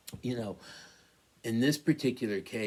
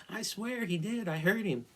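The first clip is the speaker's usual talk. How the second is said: higher, pleading insistence; insistent protest; 12 semitones higher than usual. Retold or imitated. To his doubting wife, insisting he heard it. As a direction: swear it's true, you're not making it up.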